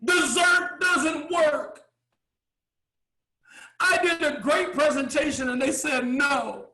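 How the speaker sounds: hammed-up huffy waiter telling the boss the diners refused dessert; waiter with attitude. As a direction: play the offended waiter whining to the boss. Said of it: "gets an attitude".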